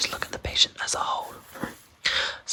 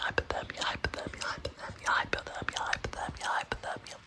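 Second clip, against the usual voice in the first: whispered trigger words; 5 dB quieter than usual. Acted. Trigger word repeated, fading.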